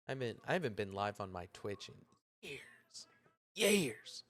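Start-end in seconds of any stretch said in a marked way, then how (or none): 2.4-3.1 breathy, fading years; whispered years. Real, not acted. Streamer marvelling at years off Twitch, whispered. 3.6-4.2 Years. lifted much higher, falling; emphatic repeat. Real, not acted. Streamer marvelling at years off Twitch, exclaimed.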